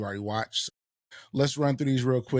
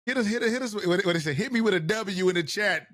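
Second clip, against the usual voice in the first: excited; 7 semitones higher than usual. Real, not acted. Hyping up viewers for votes.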